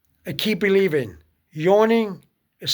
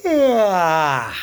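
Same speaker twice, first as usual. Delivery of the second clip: yawn; 3 dB louder than usual. Shown as a demonstration. Yawning while telling of rosary.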